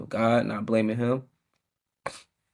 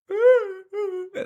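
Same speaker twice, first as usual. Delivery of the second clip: high laugh; 4 dB louder than usual. Real, not acted. Laughing at hysterical cry.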